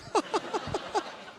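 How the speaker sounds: high, mocking cackle; hammed-up squeaky laugh; jokester's laugh. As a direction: laugh hard at your own bad joke. Jokester laughs at own joke.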